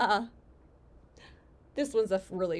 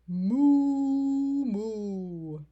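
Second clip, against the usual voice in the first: cow moo; 6 dB louder than usual. Acted. Reader plays the cow for a child, long moos. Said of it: "And the cow said".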